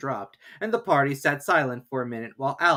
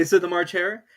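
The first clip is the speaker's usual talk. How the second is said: plain reading voice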